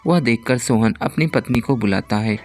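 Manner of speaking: ordinary delivery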